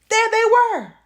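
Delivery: high, loud alarm; horrified storyteller reveal; shocked reveal. A narrator's reading. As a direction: blurt the shock. Spooky reappearance.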